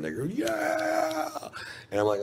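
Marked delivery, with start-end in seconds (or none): 0.2-1.3 high, drawn-out cheer; long falsetto yeah; cheering crowd. Retold or imitated. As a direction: imitate a crowd whooping its approval. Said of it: "start cheering".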